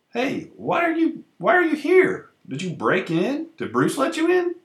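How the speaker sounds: high, startled questions; alarmed. Acted. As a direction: voice jumps up in alarm. Startled at intruder in his house.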